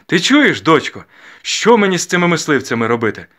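high, loud, blustering father; loud stern questioning. A narrator's reading. Father asking daughter about matchmakers, loud and blustering. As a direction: demand an answer with ritual bluster.

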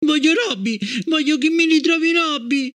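put-on high, tearful child insisting; tearful demand. A narrator's reading. Gloria to her mother, tearful insistence. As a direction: she wants only Robby. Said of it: "con le lacrime agli occhi".

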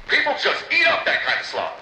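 ordinary delivery

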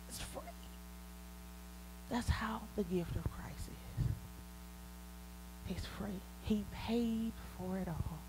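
soft reverent whisper. Real, not acted. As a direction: breathe it out like a secret. Solemn climax of sermon.

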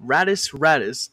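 ordinary talk, nothing marked

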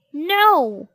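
loud defiant bellow. A narrator's reading. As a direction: bellow it back at her. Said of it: "bellowed Henry".

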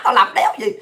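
usual speaking voice

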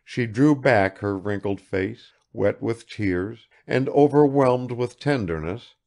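ordinary delivery

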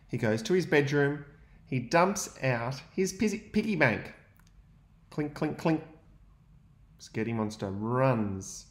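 put-on lively read-aloud for young children; story narration. A narrator's reading. Teacher reading to his class, lively storytelling. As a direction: read the picture book to the class with energy.

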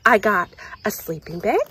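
ordinary delivery

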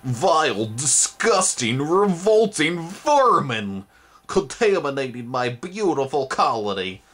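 high slow creature voice in disgust; disgusted monster rant. Acted. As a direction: spit out each insult with loathing. Gross mother ranting at Twig.

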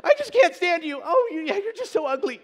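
put-on very high, loud baby-talk coo; mock loving insult. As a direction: pretend to dote on a wife while insulting her. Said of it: "I can't tell my wife".

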